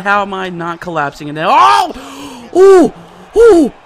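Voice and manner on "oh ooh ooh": loud, high cries, one after another; alarmed hoots. Real, not acted. Gamer taking repeated hits, loud alarmed hoots. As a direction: hoot sharply with every blow.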